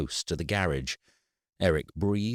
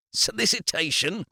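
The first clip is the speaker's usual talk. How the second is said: shocked high-pitched burst on "Solicitation"; exploded out; 14 semitones higher than usual. A narrator's reading. Eric shocked at the solicitor's accusation, exploding rising question. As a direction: blurt it out in disbelief, rising high. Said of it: "The word exploded out of Eric's mouth".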